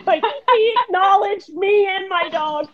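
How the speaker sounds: high, breaking with laughter; delighted disbelief spilling over; laughing. Real, not acted. Guest telling the host her highlight, laughing high.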